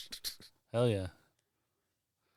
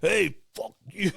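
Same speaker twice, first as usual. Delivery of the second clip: imitating a pissed-off caller, higher; angry caller; 9 semitones higher, 3 dB louder than usual. Caller on the radio gets mad, mock angry outburst. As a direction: snap it back like the annoyed caller. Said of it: "this dude got so pissed off".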